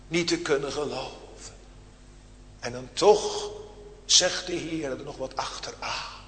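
ordinary delivery

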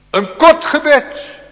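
high, loud and very slow; emphatic preaching. Acted. Preacher driving home the point, slow loud emphasis. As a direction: lean hard on each word.

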